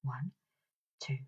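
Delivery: usual speaking voice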